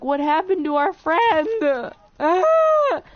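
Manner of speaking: high, hammed-up fretting ending in a scream; mock panic. Kids wondering where their missing friend went, mock panic. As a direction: fret loudly, then let out a mock scream.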